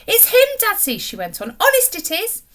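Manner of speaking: proudly presenting her captain; excited girl. A narrator's reading. Polly insists to Dadsy.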